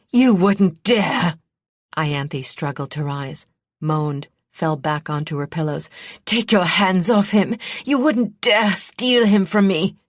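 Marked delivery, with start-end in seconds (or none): 0.1-1.4 slow, loud, high and outraged; enraged princess defying her rival; furious defiance. A narrator's reading. 6.3-10.0 loud high outraged shouting; desperate mother shouting at her rival; furious. A narrator's reading. Princess screaming at the kidnapper.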